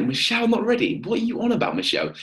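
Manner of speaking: high incredulous squeal of disbelief; mock disbelief. Coach mocking Michelle's not-ready answer in high disbelief. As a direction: act shocked she isn't ready. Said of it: "I was thinking, like".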